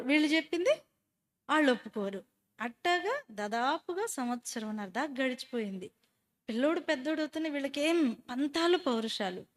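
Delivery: normal talking voice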